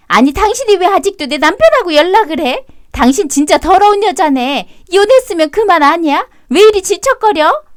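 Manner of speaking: high, indignant scolding wife; a wife's rising, furious accusation; angry accusation. Acted. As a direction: lash out at the ex-wife.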